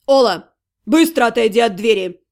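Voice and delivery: low barked command; barking order. Acted. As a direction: bark the order from the yard. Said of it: "рявкнул голос со двора". (рявкнул низким голосом)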